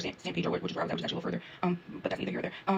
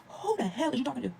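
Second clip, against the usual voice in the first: slow, raised-pitch affronted challenge; indignant comeback; 5 semitones higher, slower than usual. Acted. Traveler reacting to the lady rushing her, indignant. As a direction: draw it out, acting offended.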